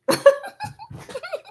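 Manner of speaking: high wheezy burst of laughter; laughing. Real, not acted. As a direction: laugh hard at the memory. Laughs at memory of mailman.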